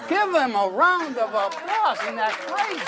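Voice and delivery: high, loud, drawn-out showman shout; rally the crowd to clap; big stage announcer flourish; rousing applause call. Real, not acted.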